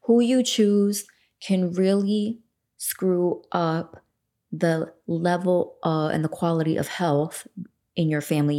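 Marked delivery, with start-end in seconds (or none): 0.0-4.0 drawn out, every word weighed; slow it right down so it sinks in; earnest slow emphasis; slow emphatic warning. Real, not acted. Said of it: "please listen to this".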